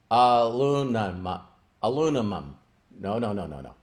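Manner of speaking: hushed, moved repetition of "Aluminum"; emotional. Retold or imitated. Reliving first saying the word.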